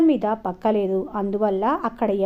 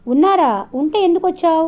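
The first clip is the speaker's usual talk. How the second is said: high, louder burst of disbelief; startled questions, pitch jumping up; astonished questioning; 7 semitones higher, 6 dB louder than usual. A narrator's reading. Old woman astonished at the answer.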